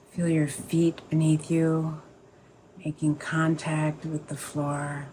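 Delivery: calm, unhurried meditation guide, pausing between phrases; guided meditation. Acted. Teacher easing the group into a sit, guided meditation voice.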